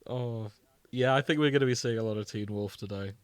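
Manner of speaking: usual speaking voice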